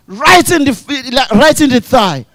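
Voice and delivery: ordinary talk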